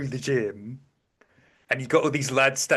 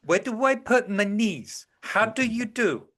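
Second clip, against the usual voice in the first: mock clueless; 8 semitones higher than usual. Clueless character imitated.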